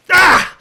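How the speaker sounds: loud, high furious yell; wrathful roar. Shown as a demonstration. Student demonstrating wrath to the priest, furious yell. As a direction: explode in a sudden rage shout. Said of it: "That was wrath".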